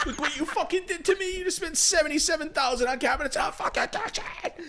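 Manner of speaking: very high, crying-laughing rant; hammed-up furious husband; shrieking laughing husband. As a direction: rant at the wife through giggles. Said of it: "You're just like".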